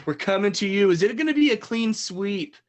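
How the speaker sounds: ordinary talk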